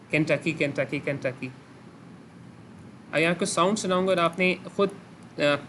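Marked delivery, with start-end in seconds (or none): 0.1-1.5 "Kentucky" repeated as a steady three-syllable beat; rhythmic flow. Shown as a demonstration. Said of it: "इसी flow के लिहाज से". ("Kentucky" को एक ही फ्लो में लयबद्ध बोलें)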